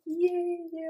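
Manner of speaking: high, delighted little cheer; happy cheer. Retold or imitated. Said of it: "I was so happy".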